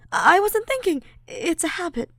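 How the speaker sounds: high, nervous, flustered friend; flustered Ash. Acted. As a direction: explain away the embarrassment.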